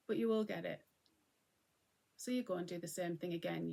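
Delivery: ordinary talk, nothing marked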